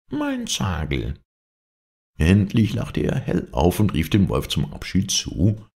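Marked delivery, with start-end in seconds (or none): 0.1-1.2 slow, high mock moan; fox's put-on lament; feigned wailing. A narrator's reading. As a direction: draw it out as if in pain. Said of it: "jammerte der Fuchs, sich verstellend".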